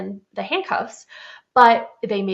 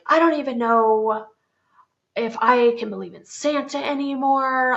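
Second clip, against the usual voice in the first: mildly upset child, lightly imitated; 4 semitones higher than usual. Young son doubting Santa to his mom, mildly upset. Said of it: "he was very upset".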